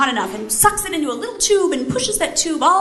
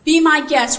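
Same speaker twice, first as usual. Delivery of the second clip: forceful challenge; 3 semitones higher, 7 dB louder than usual. Retold or imitated. Co-pilot challenges the pilot.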